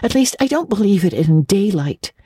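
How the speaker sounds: a hint of an anxious child; young girl Anne. Anne explaining her fears to Marilla, light girl voice.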